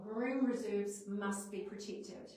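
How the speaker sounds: slow, loud, deliberate; forceful statement. Shown as a demonstration. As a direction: declare it like you mean it. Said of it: "it's the statement that you really mean".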